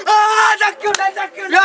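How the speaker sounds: excited shout. Acted. Excited shout over jackfruit.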